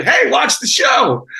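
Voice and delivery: slow, high, loud "Hey, watch the show"; mock cheerful promo. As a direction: sell the show with forced cheer. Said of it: "go on social media".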